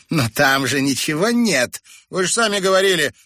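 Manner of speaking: high, loud indignant objection; protesting. A narrator's reading. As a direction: push back in disbelief. Woman protests to the men.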